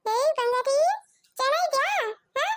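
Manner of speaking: put-on high cooing baby talk to an infant. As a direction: coo up high to the little one, playful. Aunt coos to a baby. (ಮಗುವಿನ ಜೊತೆ ಎತ್ತರದ ಮುದ್ದು ಮಾತು)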